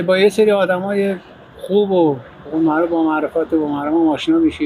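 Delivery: usual speaking voice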